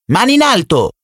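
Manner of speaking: put-on high, fierce child's yell; wild shout. A narrator's reading. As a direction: bark it like a savage kid. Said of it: "gridò una voce selvaggia". (grido selvaggio di bambino)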